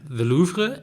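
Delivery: "Louvre" said the French way, slowly; careful French "Louvre" in English speech; French pronunciation. Real, not acted. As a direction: pronounce "Louvre" properly, as in French. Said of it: "that you pronounce it properly".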